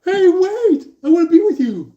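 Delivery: very high, loud acted plea; mock desperate call. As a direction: call after someone walking away. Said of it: "acting all scared to going like".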